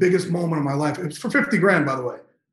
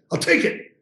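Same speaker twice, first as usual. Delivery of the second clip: higher-pitched, eager reply; eager acceptance; 6 semitones higher than usual. Retold or imitated. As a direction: jump at the offer, retold. Retold instant acceptance of job offer.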